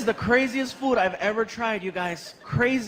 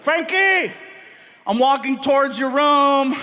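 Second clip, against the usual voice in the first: a loud, higher, slow call down the hall; 5 semitones higher, 4 dB louder, slower than usual. Retold or imitated. Dad calling his son Frankie from the hallway, loud and slow. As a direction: call to the son like announcing your arrival.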